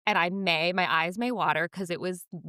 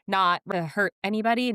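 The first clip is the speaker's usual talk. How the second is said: usual speaking voice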